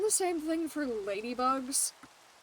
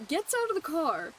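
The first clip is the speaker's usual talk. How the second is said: ordinary delivery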